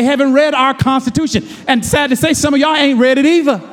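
high, loud scolding shout; loud rebuke to the church; preacher's shout. Real, not acted. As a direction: call them out from the pulpit.

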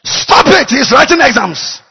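high, loud stop from heaven; shouted divine command. Retold or imitated. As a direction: forbid it with a booming shout. Heavenly voice forbids the oil.

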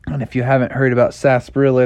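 usual speaking voice

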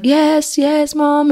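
high, drawn mimic of children; kids replying. Kids answering mom's morning questions, high mimicked voice. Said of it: "And they're like".

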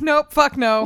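sharp shouted refusal; loud exclaim. Retold or imitated. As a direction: blurt it out loud and high. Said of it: "And I'm like".

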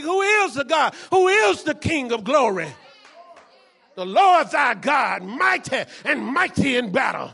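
slow, loud psalm cry; loud scripture proclamation. Acted. Preacher shouting David's psalm to the church, slow and loud. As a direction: proclaim it like a herald. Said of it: "David said last week".

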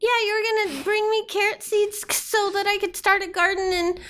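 high, chirpy small creature; little rabbit voice. Acted. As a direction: play a sweet murder bunny. GM voicing Biscuit the rabbit.

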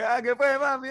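high, teasing sing-song greeting; mock doorman greeting. Doorman teasing the returning drunk teen, high mock. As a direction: imitate the mocking bouncer. Doorman recognizes him next day.

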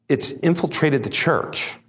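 ordinary delivery

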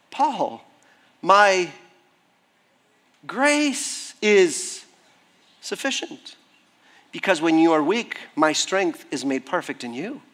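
low, slow and tender, with long pauses; tender voice of God. Acted. God comforting weary Paul, very tender. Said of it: "a very tender voice saying".

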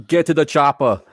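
put-on light Austrian accent as Arnold; lightly done Austrian Arnold accent; Arnold impression. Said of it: "And your Arnold accent".

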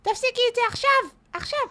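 high shrill stop it now; retold shriek. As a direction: screech the order again. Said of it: "תרדי, תפסיקי עם זה".

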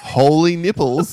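hammed-up shock, drawn out; mock astonishment. Host mimicking a moviegoer fooled by a twist, mock astonishment. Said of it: "Exactly the way everyone thinks".

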